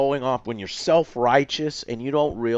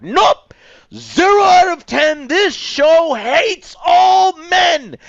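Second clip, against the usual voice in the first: mock shout, much higher and louder; mocking outrage; 10 dB louder, slower than usual. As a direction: ham up a furious hater yelling. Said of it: "where I was like".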